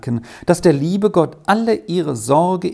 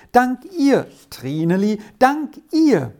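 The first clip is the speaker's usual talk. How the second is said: overjoyed thanks; 10 semitones higher than usual. A narrator's reading.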